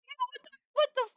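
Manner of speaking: words tumbling out in shock; startled high blurt. Real, not acted. Streamer startled by a new clip, rushed high blurt.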